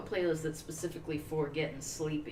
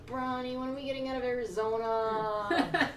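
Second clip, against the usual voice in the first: pestering; 6 semitones higher than usual. Acted. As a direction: nag like a bored kid on a road trip. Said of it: "bug the hell out of Bronny".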